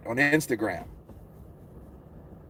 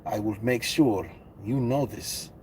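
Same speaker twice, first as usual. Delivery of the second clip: Drago voice; 7 dB quieter than usual. Retold or imitated. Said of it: "giving him the fucking Drago looks".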